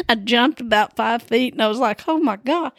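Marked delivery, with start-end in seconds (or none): 2.1-2.7 startled. Retold or imitated. Startled, jumped five feet.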